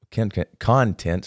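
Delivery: "content" in halting, slowed restarts; tongue-tied. Real, not acted. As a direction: stumble on "content", restarting the first syllable. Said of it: "sorry, I can't talk today".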